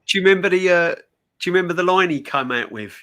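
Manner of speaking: ordinary talk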